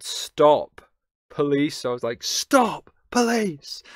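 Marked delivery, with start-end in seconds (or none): 0.0-1.8 loud call, only slightly raised in pitch; a restrained re-enactment of a shout; retold shout. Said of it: "I shouted". 2.2-3.8 high, loud shouted command; full-voiced yell, pitched way up; re-enacted shout. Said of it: "I shouted".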